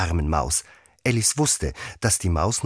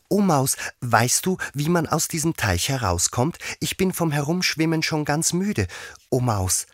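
high, earnest Alice voice; high-voiced girl asking for help; little Alice addressing the mouse; 10 semitones higher than usual. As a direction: politely call to a mouse in a higher voice.

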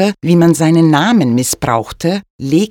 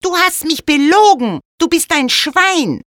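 much higher, indignant maid; 12 semitones higher than usual. A narrator's reading. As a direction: voice the maid high and accusing. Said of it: "Die Magd sagte zum Knecht".

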